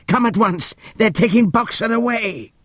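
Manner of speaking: high alarmed cry; frantic warning shouted across the field; urgent shout. Acted. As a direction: call them in a panic. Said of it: "he shouted".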